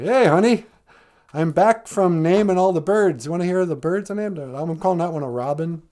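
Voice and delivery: high, loud hello to the wife; acted cheerful greeting. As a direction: play an eager husband coming home. Adam greets Eve, back home.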